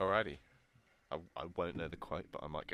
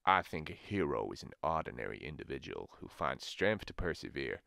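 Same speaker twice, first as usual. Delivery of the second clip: low Southern American voice; Southern American accent; 7 semitones lower than usual. Acted. Giving the quote once more, low Southern American accent. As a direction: bring it down deep, American South. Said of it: "S-, Southern American".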